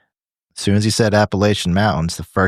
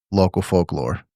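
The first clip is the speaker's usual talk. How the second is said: usual speaking voice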